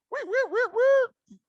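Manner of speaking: sudden shrill high shout of wait; yelling wait in sudden panic; frantic yell. Retold or imitated. Cop appears out of darkness.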